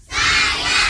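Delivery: cheer. Acted. Call-response cheer.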